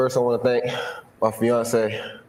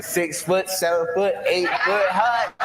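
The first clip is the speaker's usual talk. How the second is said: laughing lyric quote; 7 semitones higher, slower than usual. Real, not acted. Laughs while quoting the song lyric.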